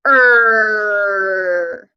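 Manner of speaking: Er as a long loud growling R, like a dog; Er, tongue back, tight, loud; growled er. Shown as a demonstration. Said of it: "sound like a dog. Let's try that again".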